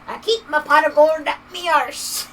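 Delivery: loud, high Irish brogue; mock Irish leprechaun. Streamer mimicking a leprechaun dad, loud Irish brogue. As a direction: ham up a cheeky leprechaun brogue. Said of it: "caffeine's father probably keeps his pot".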